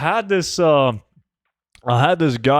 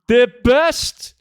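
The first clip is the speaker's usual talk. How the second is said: excited praise; 9 semitones higher, 5 dB louder than usual. Real, not acted. Hyping a viewer.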